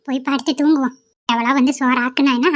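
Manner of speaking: ordinary delivery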